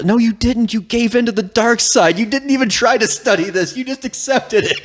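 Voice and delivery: high-pitched, hammed-up scolding; exasperated accusation. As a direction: argue back in mock exasperation. Acted quarrel with Atris.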